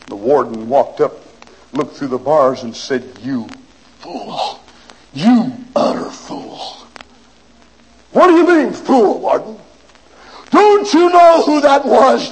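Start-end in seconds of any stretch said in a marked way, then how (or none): 3.2-6.6 scornful warden. Acted. Warden scolds the prisoner. 8.1-12.3 indignant protest. Acted. Prisoner protests to warden.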